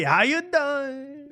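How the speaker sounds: slow, high New York greeting; hammed-up New York accent. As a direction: drawl the New York catchphrase. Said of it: "a whole nother accent".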